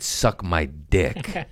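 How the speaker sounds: very slow, drawn-out insult; telling off the cheap landlord, very slowly; slow defiant retort. Retold or imitated. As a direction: spell each word out to the landlord. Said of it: "I said".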